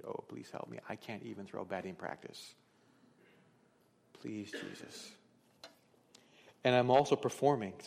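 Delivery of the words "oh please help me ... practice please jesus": pleading prayer. Pretend. Imagined prayer for help.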